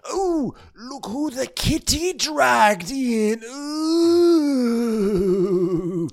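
high, slow mock-weepy drawl; Lisa's voice. Lisa greeting James, high and slow. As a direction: coo it like a grand dame. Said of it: "Lisa's like".

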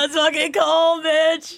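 high-pitched mock outrage; hammed-up high reaction to a burn; scandalized delight. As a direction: squeal it in a put-on high voice.

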